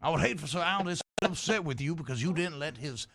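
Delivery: politely warning Southern gent; veiled threat wrapped in Southern courtesy; lightly played genteel Southern voice; Southern gentleman.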